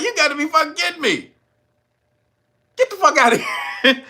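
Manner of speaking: high, fast, laughing get out; mock his angry dismissal; imitated outrage. Convict waving off the officer, laughing mock anger. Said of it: "He was like".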